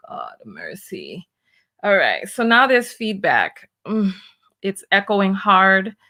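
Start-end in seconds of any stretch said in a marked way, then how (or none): 0.1-1.3 a soft whispered mercy; whispered plea. Real, not acted. Reacting to a video, a whispered plea. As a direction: breathe it out under your breath.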